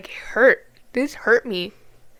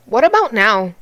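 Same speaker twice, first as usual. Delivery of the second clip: loud, indignant demand; angry challenge; 9 dB louder than usual. Real, not acted. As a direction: throw the question out with raised voice. Angrily challenging pro-life politicians.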